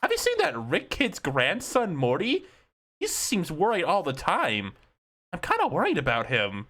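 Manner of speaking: casually concerned cartoon kid; Finn the Human voice. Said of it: "in his Jeremy Shadow voice goes".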